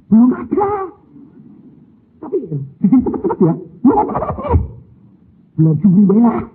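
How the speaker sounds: loud, high hurried reply; excited banter. Retold or imitated. Father answers wife about money, loud and high. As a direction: brush the worry off eagerly.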